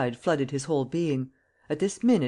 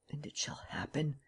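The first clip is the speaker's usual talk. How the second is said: fully whispered, no voice; 11 dB quieter than usual. A narrator's reading. Lupin whispering his vow to himself alone. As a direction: breathe it like a secret vow. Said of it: "delirious words rose to his lips".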